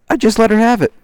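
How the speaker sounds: loud, high heated burst; loud outburst. Real, not acted. Vlogger reliving his blowup at the woman, loud. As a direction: blast it out, reliving the anger.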